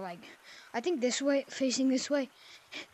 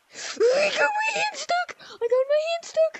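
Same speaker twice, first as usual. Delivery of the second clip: loudest fake yell; 9 dB louder than usual. Gamer fakes getting hand stuck, hammed-up screaming cry. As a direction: scream it as loud as you can, mock panic. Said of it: "I'm gonna get my hand stuck on purpose and yell".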